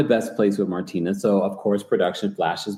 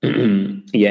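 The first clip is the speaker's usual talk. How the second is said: ordinary talk, nothing marked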